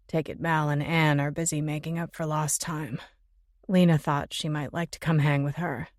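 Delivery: male voice. Acted. Jimmy voiced by female narrator.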